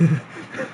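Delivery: forced breathy nervous laugh; fake laugh. Covering his shock with a fake nervous laugh in front of Josh. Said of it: "I start fake laughing".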